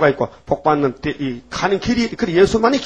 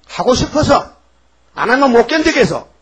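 eager urging; 8 semitones higher, 4 dB louder than usual. Real, not acted.

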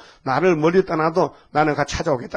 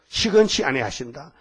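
ordinary talk

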